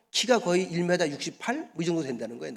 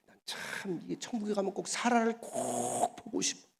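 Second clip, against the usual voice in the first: confiding hush; 11 dB quieter than usual. Real, not acted. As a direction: lean in and whisper. Preacher confides longing.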